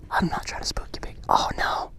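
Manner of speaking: very soft, careful whisper; hushed whisper. Real, not acted. Vlogger trying not to spook the big guy, whispering. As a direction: whisper so the animal stays put.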